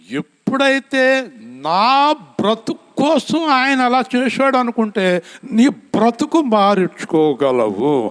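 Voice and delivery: high, loud pulpit cry, slowing at the end; fervent preaching. Acted. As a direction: drive it home to the congregation. Preaching at full force.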